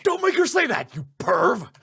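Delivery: high, loud scolding yell; angry shout. Acted. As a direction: yell at the pervert. Stopping a pervy request.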